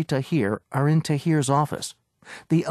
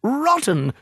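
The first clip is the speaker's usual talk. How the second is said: sudden shout; 10 semitones higher than usual. A narrator's reading. Agent suddenly shouts at the owner about her house, a sudden shout. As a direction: blast the word out to startle them. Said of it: "He suddenly shouted the last word".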